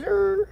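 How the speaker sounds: high whiny dog grumble; imitating a dog's whine. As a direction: squeak out a puppy's groan. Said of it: "He goes".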